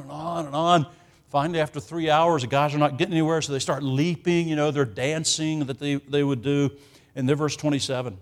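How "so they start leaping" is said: ordinary delivery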